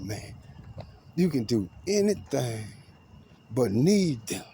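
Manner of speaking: high-pitched, emphatic quoted warning; retold councilman's warning. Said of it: "This is what the city council telling me".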